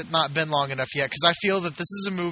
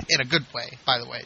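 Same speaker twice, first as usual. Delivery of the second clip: ordinary talk, nothing marked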